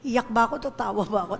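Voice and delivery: normal talking voice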